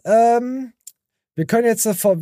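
normal talking voice

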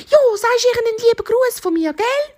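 very high-pitched cheetah voice; eager cheetah. Acted. Cheetah sees hare off, high and eager. Cheetah sends greetings to witch.

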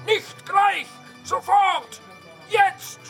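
very high, loud one-word commands; angry yelling. Acted. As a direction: scream impatiently at a slow employee. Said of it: "Der schreit ihn total an". (ungeduldig losbrüllen)

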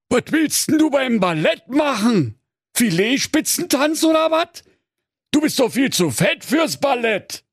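very high, incredulous octopus voice; scornful octopus. Acted. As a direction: ridicule the pig as the octopus. Octopus mocks the pig. (spöttischer Tintenfisch, sehr hoch)

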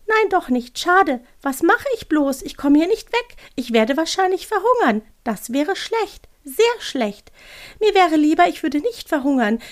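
high, plaintive owl monologue; fretting little owl. Acted. Owl stuck and talking to itself, high fretting voice. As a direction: bemoan your fate in a high, cute creature voice. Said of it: "als würde da jemand Selbstgespräche führen".

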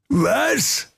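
hoher, empörter Aufschrei; tobend. Acted. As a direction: schrill empört auffahren. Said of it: "tobte König Schweinebier". (tobender König)

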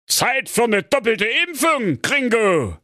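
the cowboy king throwing down a challenge; gunslinger king. Acted. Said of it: "sagte König Pedro".

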